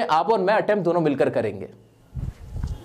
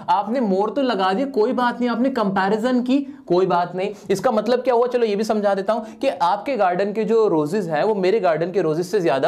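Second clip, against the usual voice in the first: usual speaking voice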